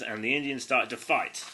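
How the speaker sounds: normal talking voice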